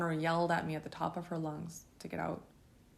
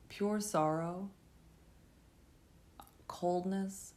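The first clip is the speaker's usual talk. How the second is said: normal talking voice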